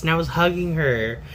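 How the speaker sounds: usual speaking voice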